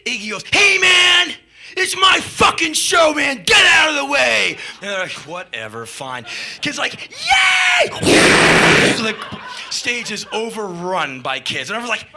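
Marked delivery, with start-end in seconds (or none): 0.5-4.6 high, loud indignant yelling; imitated angry shout. [name] orders road crew aside, angry shout. 5.2-6.2 low, soft shrug of a reply; imitated grudging resignation. Road crew gives in to [name], resigned mutter. 7.1-9.2 very high loud squeal into crowd roar; imitated kids' cheer. Kids flood the stage, squealed cheer.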